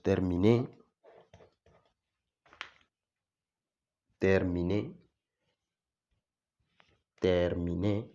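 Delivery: terminé with a clear T and rising end; French terminé. Shown as a demonstration. Teacher repeats a French example word for learners. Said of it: "la T se va a pronunciar T".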